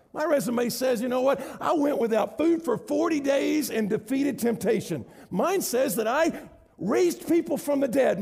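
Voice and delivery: high, slow, building proclamation; Jesus proudly listing his record; voicing Jesus. Preacher voicing Jesus offering his resume to the listener, rising and slow.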